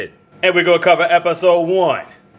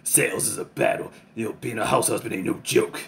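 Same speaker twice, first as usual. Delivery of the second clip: Tatsu's deep voice; 4 semitones lower, 6 dB quieter than usual. Retold or imitated. Tatsu's tagline quoted.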